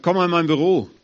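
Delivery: a hint of a stern boss; stern boss call. Boss summons to office.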